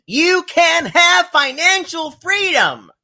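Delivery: high, slow, sugary sales pitch voice; over-the-top sales pitch; mock MLM saleswoman. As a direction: pitch it like a pushy marketing mom. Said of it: "I'm like".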